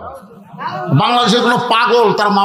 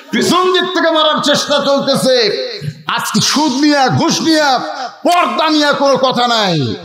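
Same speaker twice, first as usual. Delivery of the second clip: high, forceful denunciation; fiery preaching; 7 semitones higher than usual. Real, not acted. Preacher denouncing sheikhs silent on usury, high fiery preaching. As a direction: hammer out the complaints in a raised pulpit voice.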